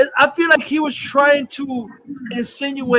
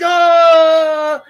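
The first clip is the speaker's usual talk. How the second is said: mock scream; 6 semitones higher, 7 dB louder than usual. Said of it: "and everyone's like".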